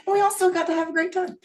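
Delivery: laughing through it, high and quick. Real, not acted. Friend laughing to the group about the trip, high and giggly. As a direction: giggle the words out fast.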